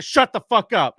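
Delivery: loud, high imitated shutdown; talking over someone. Comic steamrolling a drunk heckler, loud imitated shutdown. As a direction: cut straight across her, loudly. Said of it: "he just talked over her".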